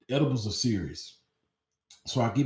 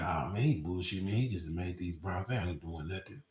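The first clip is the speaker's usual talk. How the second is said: low and soft, waving it off; dismissive low mutter; 5 semitones lower, 8 dB quieter than usual. Retold or imitated. To himself in class, brushing off the brownies, low and soft. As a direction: shrug it off under your breath.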